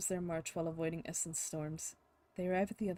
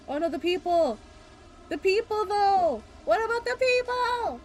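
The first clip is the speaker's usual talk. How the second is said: loud, much higher cry about the people; alarmed cry; 12 semitones higher, 12 dB louder than usual. Acted. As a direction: panic about the villagers, loud and high. Worried about villagers in storm.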